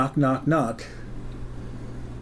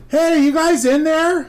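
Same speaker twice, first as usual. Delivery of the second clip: loud, high, drawn-out holler; calling out; 7 dB louder, slower than usual. Retold or imitated. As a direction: shout cheerily through a closed door. Eric calls through the door.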